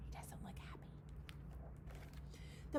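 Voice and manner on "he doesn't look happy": soft unvoiced whisper; whispered aside. Real, not acted. Aside to the children about the grumpy bison, whispered. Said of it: "aside whispered".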